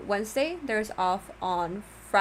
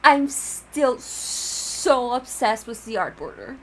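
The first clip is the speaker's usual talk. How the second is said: gushing excitement; 2 semitones higher, 9 dB louder, slower than usual. Real, not acted. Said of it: "one of my most favorite things".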